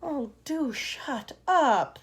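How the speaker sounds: slow, irritated drawl. Acted. Grasshopper snapping at the singing centipede. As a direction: tell him off.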